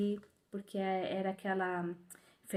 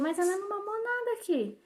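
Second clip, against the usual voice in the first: mock nurse; 11 semitones higher than usual. Nurse's scolding quote.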